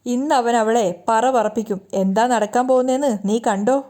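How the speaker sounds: ordinary delivery, nothing marked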